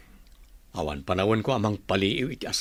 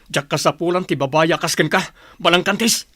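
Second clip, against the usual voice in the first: louder, higher furious insult; angry shout; 4 semitones higher, 6 dB louder than usual. Acted. Husband throwing out his cheating wife, furious shout. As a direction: spit the insult out at full voice.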